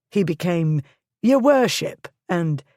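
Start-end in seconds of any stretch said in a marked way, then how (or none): 1.2-2.1 higher, loud mocking call; crowd cry. A narrator's reading. Crowd mocking the lord with titles, loud jeer. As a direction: jeer the honorific at him. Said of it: "took up the cry with variations".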